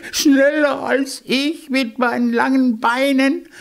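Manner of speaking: high, disbelieving mockery; incredulous hare. Hare scoffs at the hedgehog's claim, high and disbelieving. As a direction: scoff at the little challenger.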